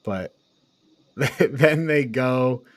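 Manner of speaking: normal talking voice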